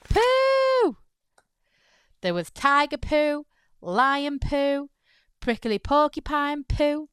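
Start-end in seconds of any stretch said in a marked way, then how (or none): 0.0-1.0 poo jumps up high and loud; squealed punchline. A narrator's reading. Narrator to children, squealing the punchline word. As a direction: squeal the word like the big joke.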